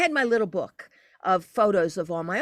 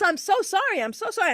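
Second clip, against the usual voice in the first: tearful apology; 9 semitones higher, faster than usual. Retold or imitated. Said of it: "and she was crying".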